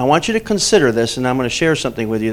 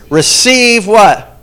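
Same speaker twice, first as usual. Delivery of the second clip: "Receive" slow, high and loud; emphatic prompt; 7 semitones higher, 8 dB louder, much slower than usual. Shown as a demonstration. Preacher prompting the congregation, high and emphatic. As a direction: punch "Receive" to prompt the crowd. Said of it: "prompting congregation".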